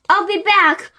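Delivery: high-pitched call to friends; mild shout. A narrator's reading. Boy promising his friends he will return, mild shout. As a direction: call it out to someone far away. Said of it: "he shouted off to his friends".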